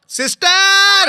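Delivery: drawn out call. Acted. Calling sister loudly.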